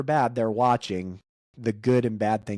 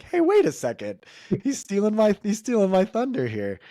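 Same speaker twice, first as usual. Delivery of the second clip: much higher mock-indignant protest; mock indignation; 11 semitones higher, 3 dB louder than usual. Host jokes the song stole his point, higher mock indignation. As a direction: play at being robbed of the punchline.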